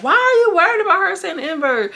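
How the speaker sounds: high, loud indignant question; indignant Raven. Retold or imitated. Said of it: "Raven pops out like".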